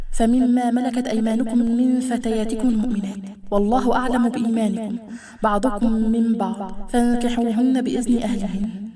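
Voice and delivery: slow, measured chanted recitation; Quran recitation. Acted. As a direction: intone the verse slowly with drawn-out syllables. (تلاوة قرآنية مرتلة)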